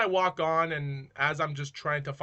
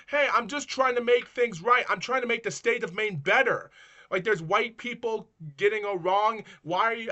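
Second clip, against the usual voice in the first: raised, high-pitched self-defence; heated protest; 7 semitones higher, 5 dB louder than usual. Retold or imitated. Helper arguing with a person crossing the street, heated protest. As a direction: argue your good intentions loudly. Retold argument with passers-by.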